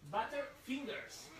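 Butter fingers said slowly in English; slow English demo. Shown as a demonstration. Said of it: "Dedos de mantequilla". (pronunciación lenta de Butter fingers)

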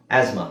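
ಆಸ್ಮಾ low, with Z for STH; Z sound. Shown as a demonstration. Teacher modelling English, ಆಸ್ಮಾ with a Z sound. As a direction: say ಆಸ್ಮಾ as az-ma, falling. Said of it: "ಝೆಡ್ use ಮಾಡ್ಬೇಕು".

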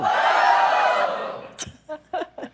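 loud breathy laugh. Real, not acted. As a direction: burst out laughing. Host laughing at bidding.

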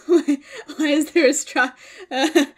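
giggling through the words, drawn out; laughing at a surprise straw. Real, not acted. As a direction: laugh it off in disbelief. Baffled by a straw in the crate.

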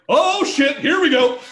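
loud, high-pitched "here comes trouble"; retold cry of alarm; alarmed exclamation. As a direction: burst out high and loud, bracing for a fight. Alarmed reaction as fight starts.

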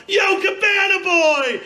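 high, slow shout across the parking lot; shouted call. Retold or imitated. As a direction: yell to a friend arriving. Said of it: "I yell out".

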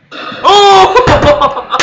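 loud high yelp into laughter; jump, then laugh it off; startled laugh. Real, not acted. Scared gamer yelps at a jump scare, laughing.

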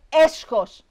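loud, high outcry; indignant shout. A narrator's reading. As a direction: shout it like an outraged crowd. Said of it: "φώναζε". (δυνατή, αγανακτισμένη κραυγή)

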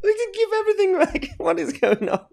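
very high squeal of disbelief; high, exasperated mockery; mock exclamation. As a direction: shoot the pitch way up, then laugh. Host mocks guest's demand.

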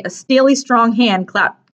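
plain reading voice

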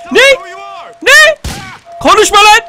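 very high, loud yelling; angry challenge. Pretend. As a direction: shout down the enemy. Silencing game enemy. (öfkeyle bağırarak susturma)

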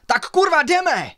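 high, very loud order to run; furious roar. A narrator's reading. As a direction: roar it across the whole forest. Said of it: "Zařval na celý les".

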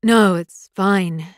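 loud, slow brush-off; abrupt. A narrator's reading. Alpha brushing off the girl's apology, abrupt. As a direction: dismiss it loudly, mildly abrupt. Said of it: "he replied abruptly".

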